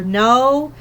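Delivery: usual speaking voice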